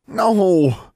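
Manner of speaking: loud, high cry of no; shouted refusal. Acted. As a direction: fling out a desperate no. Alexandra tries to pull away.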